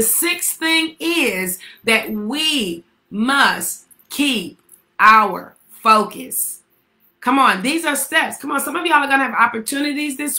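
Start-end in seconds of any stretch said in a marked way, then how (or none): 0.0-6.6 very slow, every word stretched out; drawn-out emphasis. Acted. Preacher stretching the key point to his church, very slow. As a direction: lean on each word, slowly.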